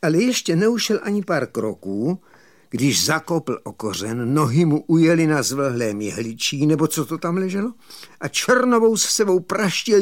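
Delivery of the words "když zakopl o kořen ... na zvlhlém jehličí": ordinary delivery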